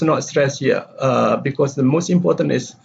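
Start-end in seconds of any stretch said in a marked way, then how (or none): none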